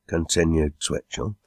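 usual speaking voice

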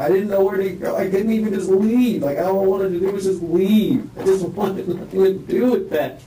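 high, strained voice on the edge of tears; distressed. Real, not acted. Man telling the interviewer he never meant her to fall, distressed and high. Still distressed, explaining himself.

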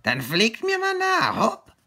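very high, loud crow command. Acted. Crow telling the friends to follow her, high crow voice. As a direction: urge them on as a screechy crow. Crow's voice in the story.

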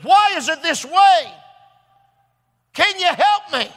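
high, loud, drawn-out outburst, retold; angry pleading. Dying man to his preacher, retold angry plea. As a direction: act out a dying man's angry demand for answers. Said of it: "the more angry he got".

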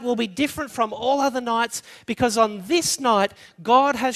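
an impassioned sermon voice; full-force preaching. Acted. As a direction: drive the point home to the congregation.

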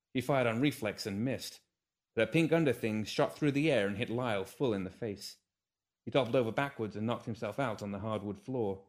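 plain reading voice

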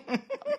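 high breathy laugh; burst of laughter. Real, not acted. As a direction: break out laughing. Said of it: "Doing that dude while laughing".

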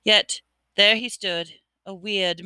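ordinary delivery

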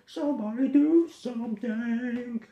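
high goofy clown voice; squeaky clown voice honking; silly clown. Acted.

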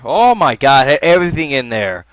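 loud wail over lost loot; dismayed outcry. Real, not acted. Gamer realising what the chest had held, dismayed outcry. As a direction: cry out loudly in disbelief.

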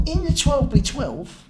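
high, loud and slow; mock argumentative insistence; retold insistent protest. Retold arguing with his sponsor.

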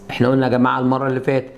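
ordinary talk

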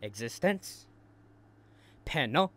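mock tearful uptalk; mocking imitation. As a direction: mimic a whiny upturned ending. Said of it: "Why are you saying it like that?".

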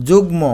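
যুগ্ম as "jug-mo", clear m; sounded m. Shown as a demonstration. Teacher showing sounded m, যুগ্ম as "jug-mo". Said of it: "এখানে ময়ের উচ্চারণ হবে".

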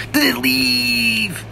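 very high, slow, silly falsetto. Pretend. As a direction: sing it up in a goofy falsetto. Sung high silly take.

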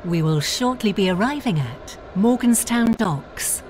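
normal talking voice

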